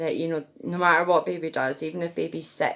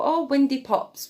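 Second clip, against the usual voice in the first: much higher playful coo; baby talk; 9 semitones higher, 4 dB louder than usual. Shown as a demonstration. Mum burping her baby, high playful baby talk. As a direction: tease the baby lightly, way up high. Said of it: "when you're burping baby, you can go".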